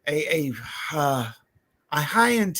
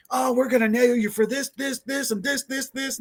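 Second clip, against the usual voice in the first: higher-pitched mock rant, piling up each "this"; ape-shit cop; 8 semitones higher than usual. Retelling the other cop losing it, mock ranting. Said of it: "starts going ape shit".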